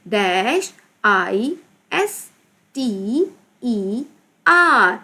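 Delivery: slow, drawn-out letter names; spelling out. Shown as a demonstration. Teacher spelling with a blank. (धीरे-धीरे, खींचकर हिज्जे)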